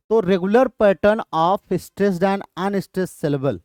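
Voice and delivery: stretched out, word by word; slow definition. Shown as a demonstration. Teacher dictating the definition of meter slowly.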